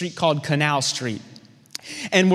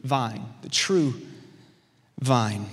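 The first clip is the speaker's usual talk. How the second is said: slow quiet declaration; 5 semitones lower, 5 dB quieter, much slower than usual. Shown as a demonstration. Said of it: "Somebody type in the chat".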